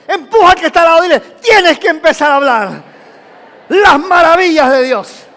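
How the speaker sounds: high, very loud exhortation; rallying shout. Real, not acted. Preacher has each tell the person beside them, rallying shout. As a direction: drive the whole crowd to join in. Rallies congregation to tell neighbour.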